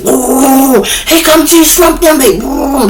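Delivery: high whooping crowd imitation; rowdy inmates. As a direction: hoot and holler as the jail welcomes him. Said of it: "It's rowdy".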